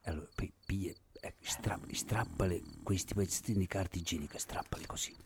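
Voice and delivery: hushed step-by-step instructions; soft, half-whispered prank coaching; conspiratorial whisper. Acted. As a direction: guide an accomplice without waking the sleeper. Secret prank instructions at night.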